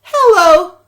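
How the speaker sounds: loud high hello; spring the silly twist; surprise punchline. Acted. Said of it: "George went".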